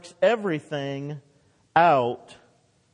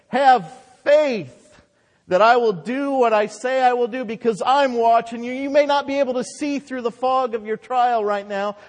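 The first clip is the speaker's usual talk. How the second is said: loud, high preaching urgency; God exhorting; 7 semitones higher, 6 dB louder than usual. Retold or imitated. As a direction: urge them forcefully, speaking as God. Preacher voices God's urgent command.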